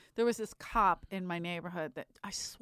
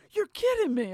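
high, astonished exclamation; mock disbelief; 11 semitones higher, 4 dB louder than usual. Cop's partner reacting in shocked disbelief. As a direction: act amazed at what you just heard. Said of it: "her partner's going".

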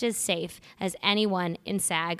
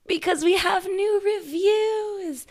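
sing-song excitement; 12 semitones higher, 3 dB louder, slower than usual. Acted. Teasing build-up to good news.

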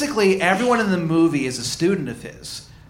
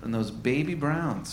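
soft, slow, mock-dreamy; mock romantic; 2 semitones lower, 8 dB quieter than usual. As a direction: croon it like a teasing lover. Mock flirting with cohost.

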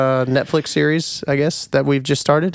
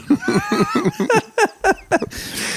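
squeaky laugh at own joke; high burst of laughter. Real, not acted. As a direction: break into a high giggle. Laughs at own robot bit.

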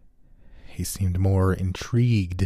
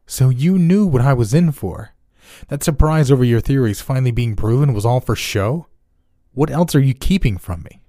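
loud, raised accusing voice; angry accusation; 6 semitones higher, 6 dB louder than usual. A narrator's reading. Jack angrily confronts the lying doctor. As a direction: confront him with it, furious.

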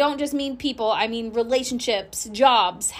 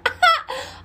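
high laugh. Real, not acted. Laughs at herself.

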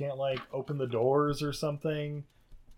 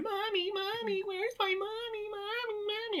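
put-on crying little girl; 6 dB quieter than usual. Podcaster mimicking the girl in the movie, high teary whine. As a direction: whine for mommy like a scared kid. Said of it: "the girl's just screaming".

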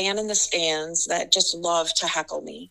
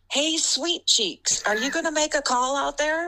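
heckler's catcall; 8 semitones higher than usual. Retold or imitated. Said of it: "he just had so much fun yelling at me".

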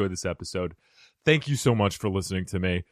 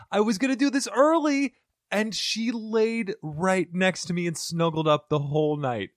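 high, gushing and excited; 10 semitones higher than usual. Real, not acted. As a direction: gush over the sweet kitty. Gushing about cat snuggling.

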